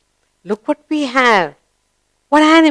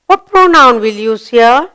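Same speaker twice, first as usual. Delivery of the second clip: normal talking voice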